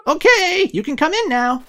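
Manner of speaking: put-on high playful British child voice; giggly British twins setting up the game; British ghost kids. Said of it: "I gave them a British accent".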